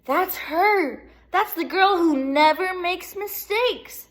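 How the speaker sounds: high, loud, thrilled fan voice; excited crowd woman. A narrator's reading. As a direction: gush about her like a starstruck fan. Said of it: "said a woman".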